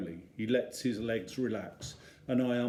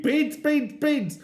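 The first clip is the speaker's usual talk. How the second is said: high, loud mock toddler chant; imagined toddler yell; 6 dB louder, slower than usual. Dad imagining screaming beans round the supermarket like Eddie. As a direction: yell it like a toddler having fun. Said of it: "I want to yell my head off too".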